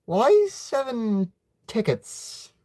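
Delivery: high, slow, puzzled question. A narrator's reading. Nephew puzzled by uncle's tickets, questioning slowly. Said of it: "You question".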